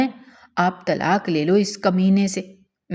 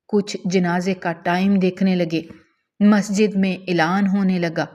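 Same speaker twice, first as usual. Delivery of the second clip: ordinary delivery, nothing marked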